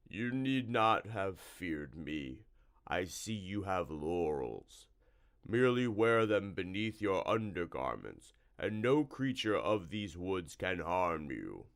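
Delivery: a slow, oozing monster voice; a lightly voiced slime monster, unhurried; slow slimy creature. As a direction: drawl the answer like a swamp creature. Narrator voicing Slimy Bar Sinister.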